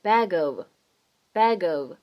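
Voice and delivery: bag of linked into bagov, slow. Shown as a demonstration. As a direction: run bag into of. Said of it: "可以做连读".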